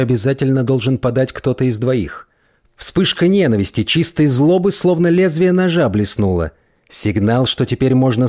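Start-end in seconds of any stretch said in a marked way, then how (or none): none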